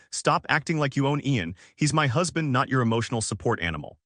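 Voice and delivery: plain reading voice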